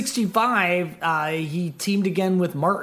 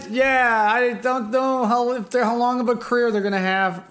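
lightly mocked doubting producer, pitched up; doubtful George Martin; 5 semitones higher than usual. Producer wondering about the band's career, lightly mock doubtful. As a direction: hesitate as an unsure record producer. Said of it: "Can you imagine that? Just like".

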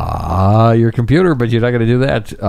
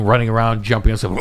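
ordinary delivery

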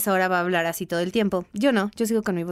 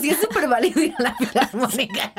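riendo; 5 semitones higher than usual. Real, not acted. Se ríe de su propio comentario.

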